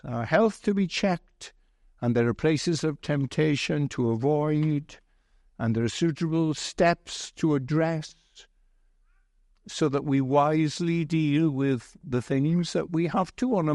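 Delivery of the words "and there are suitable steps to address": ordinary delivery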